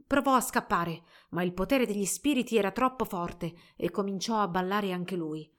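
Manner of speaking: plain reading voice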